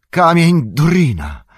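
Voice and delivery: loud joyful dwarf's shout. A narrator's reading. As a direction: call out the stone's name in awe. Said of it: "krzyknął Gimli".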